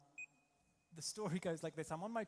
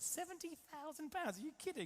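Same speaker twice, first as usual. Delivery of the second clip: much higher-pitched, astonished mock disbelief; incredulous outburst; 11 semitones higher than usual. Pupil to flying instructor on hearing the price, shocked disbelief. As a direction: replay your shock in a high squeak.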